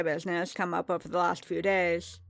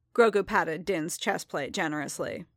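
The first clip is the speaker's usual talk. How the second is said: ordinary delivery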